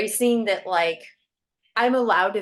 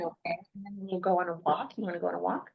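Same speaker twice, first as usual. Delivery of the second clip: soft mom voice; 2 semitones lower, 7 dB quieter, much faster than usual. Real, not acted. Mother soothing child quietly.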